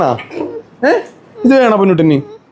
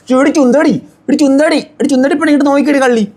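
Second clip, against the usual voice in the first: high, sing-song teasing call; 8 semitones higher than usual. Real, not acted. Uncle teasing the little one to look at him, playful baby talk.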